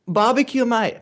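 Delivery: high-pitched mock Aussie; badly faked Australian accent; attempted Australian accent. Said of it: "anything but, but Australian".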